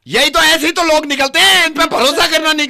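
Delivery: high, loud, relentless yelling; furious shouting. Acted. Husband yelling at the stranger over his wife, furious. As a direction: tear into him for taking sides. Staged fight continues.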